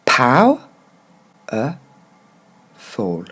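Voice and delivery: "Pow-, e-, foul" in three parts with long pauses; syllable by syllable. Shown as a demonstration. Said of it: "on va le décortiquer".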